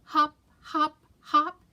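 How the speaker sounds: high, bouncy hop sounds; playful hopping chant. A narrator's reading. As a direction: sing out each hop. Bunny hopping sound words.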